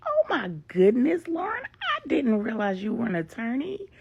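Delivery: high and loud, thrilled disbelief; excited surprise. Acted. Angela thrilled to find her old friend is her lawyer, high and loud. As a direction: gasp it out, overjoyed to reconnect.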